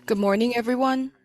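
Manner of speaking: ordinary talk, nothing marked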